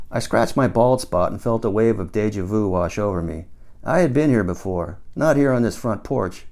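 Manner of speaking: ordinary delivery, nothing marked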